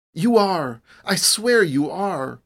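high, loud, fervent assurance; fervent insistence. A narrator's reading. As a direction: swear it to her with all conviction. Paul fervently reassures her.

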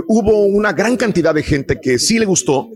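normal talking voice

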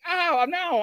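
high-pitched cover-up; retold hasty denial. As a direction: brush the question off in a high voice. Retells fibbing to companion.

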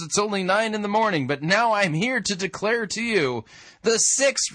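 mock sermon, pitched high; Apostle Peter. Critic parodying Peter at Pentecost, mock proclamation. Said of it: "I don't remember the Apostle Peter preaching these phases".

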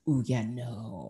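low, slow, softly dismissive no; reluctant refusal. Retold or imitated. Viewer who has gone off a reality show, low slow refusal. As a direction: back away from it slowly, voice dropped. Acting out turning against the show.

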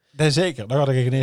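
put-on Brabant accent. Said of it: "steeds meer Brabants te praten".

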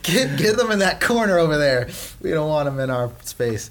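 laughing, high mock alarm at a sick coworker; playful panic over germs; mock shooing. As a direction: shoo them into the corner, half-joking. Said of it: "It's like".

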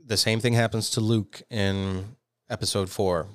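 usual speaking voice

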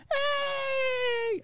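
very high squealed cheer; word-of-the-day scream. Retold or imitated. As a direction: shriek like a TV playhouse celebration. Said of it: "if this were Pee-wee's Pay-, Playhouse".

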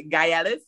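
three syllables in "gayales", opening like guy; mispronounced. Retold or imitated. Recalling what came out, "gayales" mispronounced as guy-AL-us. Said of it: "wasn't thinking in my Caribbean accent".

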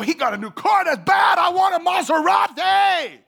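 high, loud envious gushing; loud, high covetous excitement; envious admiration. Retold or imitated. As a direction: gush over the neighbor's car. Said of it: "and go".

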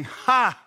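loud, high scoffing ha. Retold or imitated. Sarah scoffing at a promised son, loud and high. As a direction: bark out a doubting laugh. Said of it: "she's saying".

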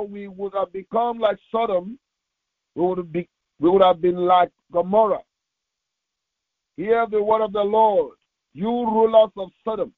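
normal talking voice

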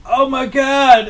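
high, loud, slow gasp; mock astonishment. A retold father seeing his son's eyes open, high and loud. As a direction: stretch it out like you've just seen a miracle. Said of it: "He's like".

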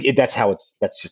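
ordinary delivery

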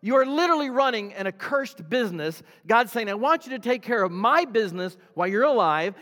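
loud, high-pitched preacher delivery; full-force preaching. Real, not acted. Preacher warning the congregation about a cursed business, loud and forceful.

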